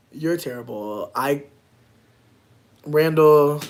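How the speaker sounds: ordinary talk, nothing marked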